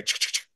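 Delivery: quick soft ch-ch bursts, then stop short; skittering little clicks in an uneven burst; sporadic squirrel scratching. Shown as a demonstration. Hunter shows listeners how a squirrel moving sounds: quick uneven soft bursts. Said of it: "Squirrels are very sporadic".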